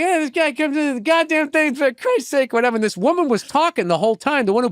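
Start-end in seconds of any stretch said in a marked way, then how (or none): 0.0-2.5 high, rushed ranting patter; mimicked stand-up act. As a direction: imitate his own act, pitched way up. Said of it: "So I was like".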